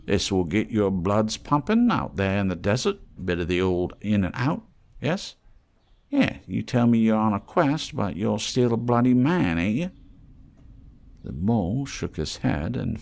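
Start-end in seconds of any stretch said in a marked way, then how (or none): 0.0-9.9 higher, sly haggler's voice; wheedling trader voice. Acted. Scruffy trader teasing the mole, higher and sly. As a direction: coax the stranger like a street trader. Reno pushing a magazine.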